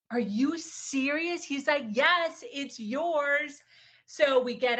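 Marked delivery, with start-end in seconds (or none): 0.1-1.4 slow, loud, incredulous question; retold astonishment. Retells realising the car is hers. 1.9-3.6 loud, high, emphatic confirmation; retold triumphant reveal. Retells his reveal of the gift.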